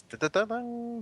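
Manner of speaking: rising high "da-da-da" fanfare; mock fanfare. Teacher revealing the million dollar word, mock fanfare. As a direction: imitate a drum-roll build-up, pitch climbing.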